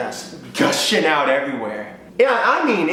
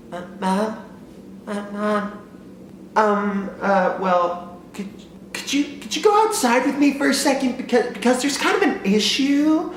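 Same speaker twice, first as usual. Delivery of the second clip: higher, hesitant stammering voice; timid child asking mom to come outside; nervous kid; 8 semitones higher than usual. Retold or imitated. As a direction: stall nervously before confessing. Nervous kid confessing trouble.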